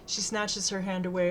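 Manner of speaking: plain reading voice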